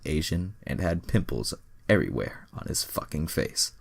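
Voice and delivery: usual speaking voice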